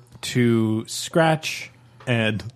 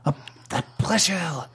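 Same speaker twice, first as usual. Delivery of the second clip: softer, savouring French repeat; French accent; 2 semitones higher, 7 dB quieter than usual. Acted. Bomb confessing a pleasure to a reluctant listener, softer French accent. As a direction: linger softly on it in mock French. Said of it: "It's got eyes and a French accent".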